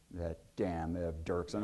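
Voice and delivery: low, slow, soft grumble; low grumbled curse. Retold or imitated. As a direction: mutter it through disgust. Kennedy's muttered curse.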